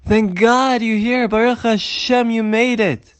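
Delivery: high, loud, drawn-out exclamation; overjoyed welcome. Acted. The Baal Shem Tov welcoming Shmulek back to shul, overjoyed. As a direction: cry out in relief and delight. Rebbe now joyfully welcomes him.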